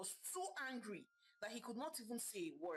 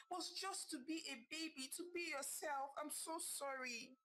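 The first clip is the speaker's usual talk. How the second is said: sadly; 8 semitones higher than usual. A narrator's reading. Mother apologizing to her baby, sad and tender. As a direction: say sorry to your baby from the heart. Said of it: "Zina said sadly".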